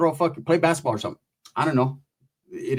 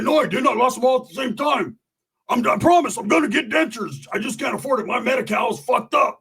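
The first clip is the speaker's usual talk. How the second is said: high-pitched mock defensive whine; indignant protest; 7 semitones higher than usual. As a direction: plead your case, pitched up and put-upon. Roach acted defending his missing teeth.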